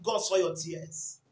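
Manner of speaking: usual speaking voice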